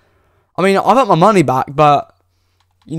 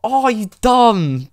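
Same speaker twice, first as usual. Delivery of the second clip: high, slow, drawn-out outrage at the game; yelling at the screen in disbelief; exasperated shout; 9 semitones higher, much slower than usual. Real, not acted. Pack opener yelling at the game over a bad pull, high and slow outraged shout.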